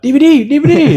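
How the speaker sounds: high-pitched eager chant; mock excited call; calling out for DVDs. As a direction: call it out high, like kids hailing a street seller. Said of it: "and we're like".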